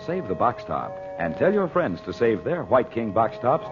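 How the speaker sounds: normal talking voice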